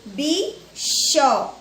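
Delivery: "bicho" as two stretched beats, bi, cho; split into syllables. Shown as a demonstration. Teacher giving a two-syllable example, split into syllables. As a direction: pull "bicho" apart into bi... cho. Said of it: "dois números de sílaba".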